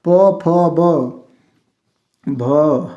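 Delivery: very slow, separated प फ ब भ from the lips; lip consonants demo. Shown as a demonstration. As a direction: spell out प फ ब भ slowly with the lips. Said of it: "इसको बोलने में कहाँ से आवाज़ आ रही है?". (होठों से धीरे धीरे प फ ब भ)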